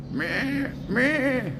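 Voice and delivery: high nasal meh like a goat; goat bleat. Pretend. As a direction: bleat back at the goats. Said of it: "I sound like a goat".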